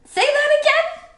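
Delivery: very high, loud little squeak; startled excitement. A narrator's reading. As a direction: burst out in astonished delight. Stuart startled, sits bolt upright.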